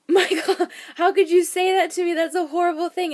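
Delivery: high, loud retold outcry; mock indignation. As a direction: scold him in hammed-up hurt disbelief. Acted reproach of husband.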